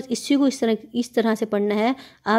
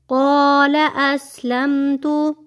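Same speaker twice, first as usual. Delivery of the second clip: slow chanted recitation, heavy qaf; stretched tajweed chant; Quranic recitation; 2 semitones higher, much slower than usual. Shown as a demonstration. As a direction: recite slowly and melodically. Recites verse.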